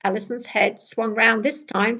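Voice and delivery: ordinary delivery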